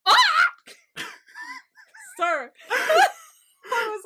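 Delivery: choking with laughter at the scene; laughing hard. Real, not acted. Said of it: "I was, like, choking".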